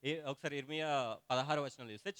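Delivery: ordinary talk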